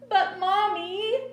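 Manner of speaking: put-on high, loud, drawn-out child's whine; starting to get sobby. A narrator's reading. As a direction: plead like a little girl near tears. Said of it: "starting to get all sobby".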